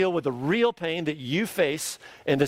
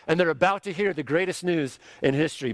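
usual speaking voice

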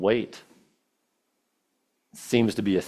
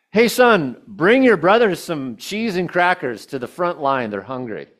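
a higher, louder dad calling to his son; father's call; 6 semitones higher, 3 dB louder than usual. Acted. Father sending David to his brothers, a high loud call. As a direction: call out like a father giving an errand.

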